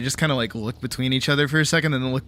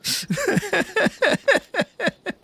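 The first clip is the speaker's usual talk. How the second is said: high-pitched sustained laughter; amused laugh. Real, not acted. Fan enjoying a favourite sitcom, amused laugh. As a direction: giggle on helplessly at the show.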